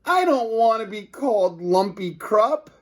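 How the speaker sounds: high, whiny protest; whiny principal. A narrator's reading. Principal complains about name.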